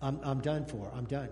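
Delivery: low, soft sighing resignation; sighing despair. Retold or imitated. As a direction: sigh it out like a doomed prisoner. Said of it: "they sigh and they go".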